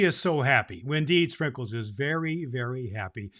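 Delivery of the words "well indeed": usual speaking voice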